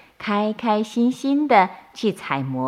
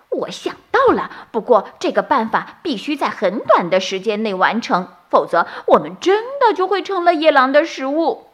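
clever bunny; 6 semitones higher, faster than usual. A narrator's reading. Clever bunny explains plan.

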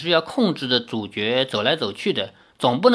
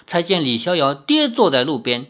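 plain reading voice

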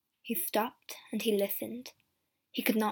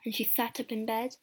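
ordinary delivery

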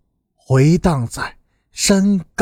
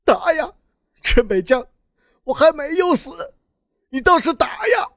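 high-pitched, desperate begging without raising volume; pleading; 8 semitones higher than usual. A narrator's reading. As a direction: implore him to keep striking. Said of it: "恳求着". (苦苦恳求)

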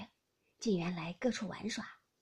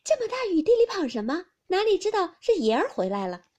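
high, loud, amused exclamation; 8 semitones higher, 9 dB louder than usual. A narrator's reading. Maid greets drenched master at the gate, amused and loud. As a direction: exclaim loudly, pitched well up. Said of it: "笑得弯着腰，".

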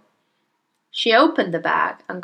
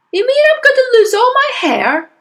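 very high, loud panic; yelling husband; 8 dB louder than usual. Acted. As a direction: yell it in horrified disbelief. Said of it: "the husband yelled".

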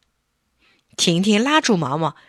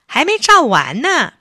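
higher, louder puppet calling out; 8 semitones higher, 6 dB louder than usual. Acted. Puppet on the table calls to everyone, louder. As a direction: announce it eagerly to the room. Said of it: "举着照相机嚷嚷".

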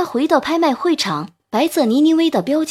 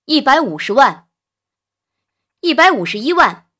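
raised-voice auction bids; calling bids; 4 dB louder than usual. A narrator's reading. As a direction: call out bid and counter-bid. Rival bidders calling out.

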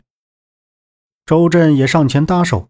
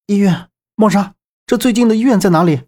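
high-pitched, frantic question; urgent; 7 semitones higher, faster than usual. A narrator's reading. 周震 asking 孟莎 for the nearest hospital, urgent. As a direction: ask urgently, voice shooting up. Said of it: "急切地向孟莎问道".

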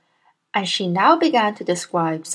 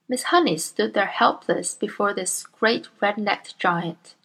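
usual speaking voice

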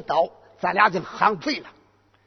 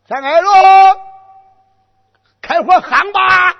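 goading yell; 10 semitones higher, 11 dB louder than usual. Acted. Boy goading general again.

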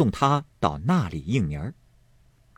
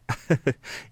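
a short soft chuckle; a friendly little laugh; light laugh; 6 semitones higher, 3 dB quieter than usual. A narrator's reading. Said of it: "柳家的笑道". (轻轻一笑)

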